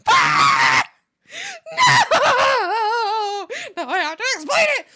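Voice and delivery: loud, high dismayed cry; mock panicked shout. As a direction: squeal in horror at having to explain. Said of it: "And I'm like".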